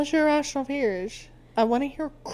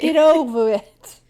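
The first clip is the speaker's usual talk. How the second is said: slow, louder failed New York accent; bad New York accent; 5 semitones higher, 10 dB louder, slower than usual. Shown as a demonstration. Host admits she can't do New York, attempts New York accent anyway. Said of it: "I can't do a New York accent".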